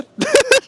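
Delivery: laughing burst after the fall. Real, not acted. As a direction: let out a high laugh.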